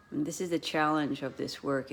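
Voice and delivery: ordinary delivery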